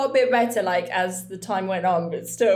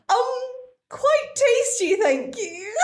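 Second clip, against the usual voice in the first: higher, slow, polite little voice; mock polite reply, higher and slow; prim mock reply; 14 semitones higher, much slower than usual. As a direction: answer like a prim guest at tea. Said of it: "Oh, yeah, does my dick taste nice?".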